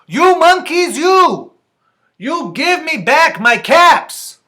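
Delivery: high, loud acted demand; angry peddler. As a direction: shout crossly at naughty monkeys, slowing on the demand. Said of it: "This made the peddler angry".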